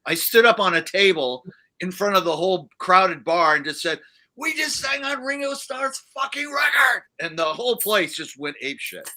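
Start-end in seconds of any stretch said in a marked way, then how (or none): 4.3-7.0 much higher retold shout to the bar; drunken shout. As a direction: yell it from the tabletop to the crowd. Drunk on table addressing bar.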